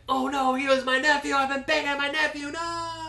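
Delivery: high, loud horrified wail; horrified shriek. Retold or imitated. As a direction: shriek it like a panicked queen. Dany's horrified realization mocked.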